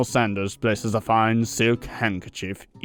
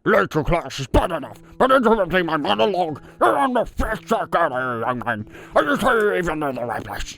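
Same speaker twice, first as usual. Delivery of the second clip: scolding teacher; 6 semitones higher than usual. Acted. Teacher scolding late student.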